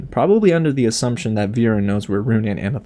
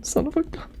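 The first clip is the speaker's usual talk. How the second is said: high astonished; 7 dB quieter than usual. Real, not acted. Stunned reaction before reading the letter.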